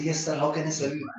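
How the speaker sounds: sugary, fawning bank-clerk tone; servile politeness. Retold or imitated. Bank clerk greeting a millionaire customer, mock fawning. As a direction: turn suddenly sweet for the rich client. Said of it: "My tone changes".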